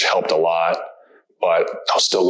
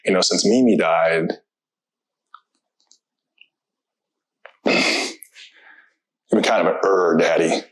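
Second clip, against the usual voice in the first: son's words, voice breaking; slower than usual. Real, not acted. Son's voice, speaker choking up.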